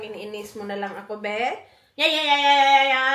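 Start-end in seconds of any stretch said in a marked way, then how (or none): 2.0-3.1 loud babbling. Real, not acted. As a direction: babble loudly on one syllable. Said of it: "Ang lakas ng boses nitong batang to".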